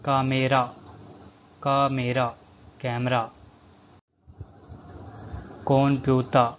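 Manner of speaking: ordinary delivery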